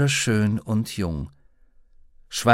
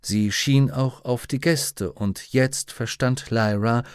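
usual speaking voice

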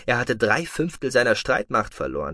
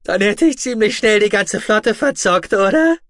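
very high, loud, near-hysterical voice; shrill; 10 semitones higher, 8 dB louder than usual. A narrator's reading. Otoba laughs at losing the whole fleet, shrill. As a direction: pitch it shrill and loud, soaring on the last word. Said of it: "Seine eigene Stimme klang schrill".

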